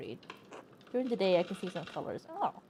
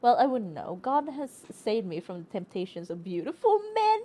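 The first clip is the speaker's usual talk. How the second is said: ordinary talk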